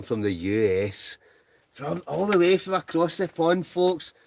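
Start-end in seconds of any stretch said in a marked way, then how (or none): 1.7-4.1 high-pitched, excited host voice; showman's announcement. Real, not acted. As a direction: announce a far-off guest to the crowd, pitched way up. Announcing an overseas viewer to audience.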